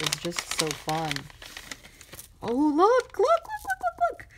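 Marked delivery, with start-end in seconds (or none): 2.4-4.3 high, loud rapid-fire excitement; excited. Real, not acted. Showing viewers a new find, excited. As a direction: squeal with delight at a new find. Spots something while showing the gifts.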